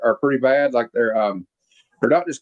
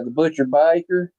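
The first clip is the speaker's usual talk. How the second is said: drawn-out mock Texas accent on "Butcher Baker"; bad Texas accent; 2 semitones higher than usual. Reviewer repeating the killer's name, slow fake Texas accent. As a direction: stretch it out in a bad Texas twang. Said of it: "Texas accent I've ever heard".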